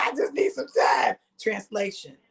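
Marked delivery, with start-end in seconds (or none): 0.0-1.2 breathy quoted dad. Retold or imitated. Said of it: "He said".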